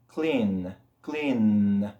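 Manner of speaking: "clean" with a held, humming N; final N. Shown as a demonstration. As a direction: let the N ring at the end of "clean". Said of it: "el sonido de la N al final".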